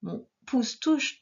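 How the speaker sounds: ordinary talk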